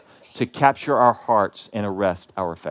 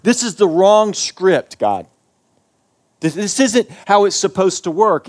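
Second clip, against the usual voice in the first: louder and higher, crying out against God; impassioned protest; 7 semitones higher, 8 dB louder, slower than usual. Acted. Preacher reliving his protest at God, loud and high. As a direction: let it burst out as a raw protest.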